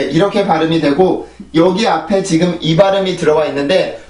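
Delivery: usual speaking voice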